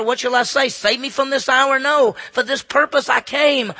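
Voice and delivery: loud preaching, slowing on the quotes; preaching at full force. Acted. Preacher voicing Jesus facing the cross, full force preaching.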